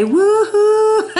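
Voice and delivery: high, loud woohoo; excited cheer. Real, not acted. Announcing her forty-second birthday to viewers, loud happy cheer. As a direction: cheer out loud for the big day.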